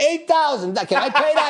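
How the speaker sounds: high, loud, laughing disbelief; yelling out in the courtroom with a laugh; excited yell. Retold or imitated. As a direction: blurt it out like you can't believe your luck. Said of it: "And I yell out".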